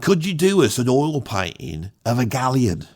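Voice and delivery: lightly voiced Cockney bloke, quick; a hint of a Cockney geezer asking for a favour. Cockney bloke commissioning a galleon painting, mild Cockney. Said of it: "I'll do that accent".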